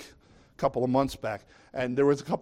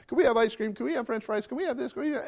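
put-on rising, pleading kid voice, each ask climbing higher; begging kids; 6 semitones higher, faster than usual. Kids in the back of the car begging dad for treats, rising pleading. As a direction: pester like a kid in the back seat wanting treats. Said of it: "they're chatterboxing".

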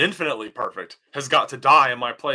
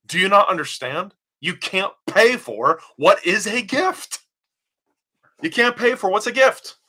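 rising preacher's rebuke; 7 semitones higher than usual. Real, not acted.

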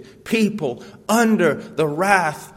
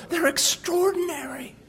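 much higher, breathy awestruck; mock awe; 12 semitones higher than usual. Preacher mimics people gazing at heroes of faith, awestruck. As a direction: sigh it out, dazzled. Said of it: "You look at them and go".

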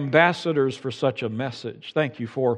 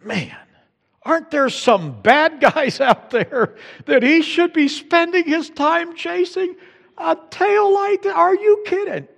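much higher, slow, mock-outraged complaint; indignant grumble; 4 dB louder than usual. Driver griping to himself after the stop, mock-outraged. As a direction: scoff at the officer wasting time on a taillight. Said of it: "something like this".

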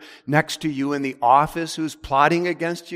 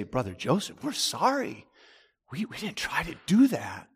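imagined whispered apology; 3 semitones higher, 5 dB quieter than usual. Retold or imitated.

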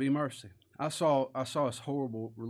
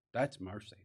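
usual speaking voice

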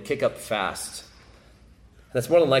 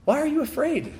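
gently incredulous. Acted. Repeated with wonder.

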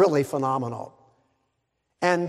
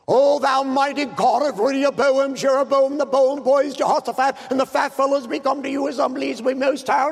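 high, loud pompous prayer parody; mock elaborate prayer; 8 semitones higher, 4 dB louder than usual. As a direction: rattle off an over-grand prayer in a raised voice. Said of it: "didn't get too elaborate".

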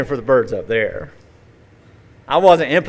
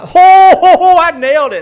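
much higher and louder, whooping with glee; gleeful triumph; 13 dB louder than usual. Retold or imitated. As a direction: crow over the hit like a gleeful teen. Teen gloating over bird hit.

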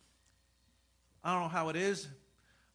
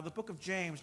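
usual speaking voice

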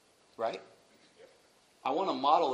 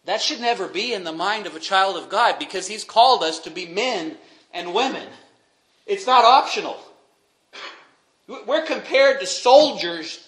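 fiery preaching; 6 semitones higher, 12 dB louder than usual. Acted. Preaching at full force.

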